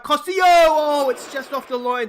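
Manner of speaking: melonjak tinggi dan keras, hampir gol; excited shout. Real, not acted. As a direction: teriak kaget saat bola nyaris masuk. Goal chance in live match.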